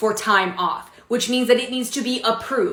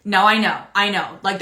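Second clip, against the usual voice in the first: ordinary talk, nothing marked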